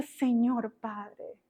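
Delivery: slow prayer. Retold or imitated. Slow prayerful address to God.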